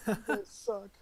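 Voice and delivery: laughing through a mock complaint; half-laughing, pretending to be offended. Real, not acted. Player laughing as friends joke about the tarot deck.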